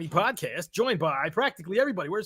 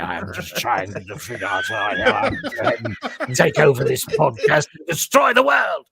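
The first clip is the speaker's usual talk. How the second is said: British villain; 6 dB louder than usual. Pretend. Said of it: "classic British villains there".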